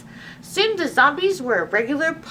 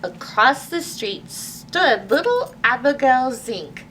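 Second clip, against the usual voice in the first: plain reading voice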